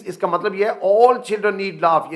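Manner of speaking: usual speaking voice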